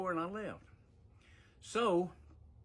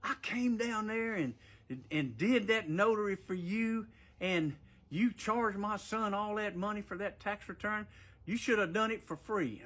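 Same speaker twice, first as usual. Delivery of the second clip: mild mock outrage, pitched higher; retold angry rant, a touch higher; mother going ballistic; 6 semitones higher than usual. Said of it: "his mother went ballistic".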